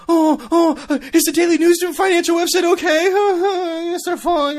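high loud mock alarm; mock panic. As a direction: shriek it in comic panic. Mock panic over stock site.

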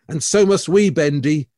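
usual speaking voice

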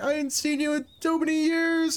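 high hammed-up hello across a crowded hall; imitating an old friend's greeting. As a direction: squeal it like you're spotting a long-lost friend. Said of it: "'cause it'll be like".

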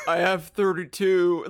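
loud, slow, laughing through the words; cracking up while quoting him; laughing mock quote. Friend laughing as she quotes Cage at the gift shop, loud and slow. Mocking Cage paying with change.

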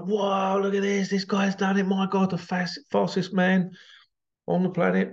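high, amazed cheering voice; excited crowd. Retold or imitated. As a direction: gush like a stunned fan. Crowd reacting to the record.

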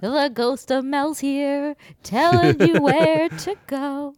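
high, slow ghostly wail; spooky ghost voice. Pretend. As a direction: moan it like a haunting ghost.

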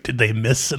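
ordinary talk, nothing marked

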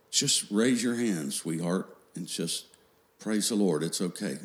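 put-on softly coaxing a young child; gentle encouragement. Parent coaxing a young child in church, gently encouraging. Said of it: "we would encourage them in church".

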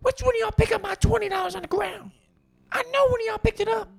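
high, raspy furious shout; angry woman yelling. Retold or imitated. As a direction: yell at the whole store. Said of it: "here I go".

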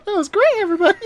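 mock sweet high voice; high cheery voice. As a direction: switch from gruff to squeaky cheer. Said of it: "second mission ended".

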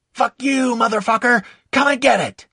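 high, loud defiant scream; furious yell. Acted. Soldier taunts enemy in battle.

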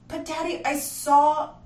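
put-on a small boy cut off mid-protest; child protesting.

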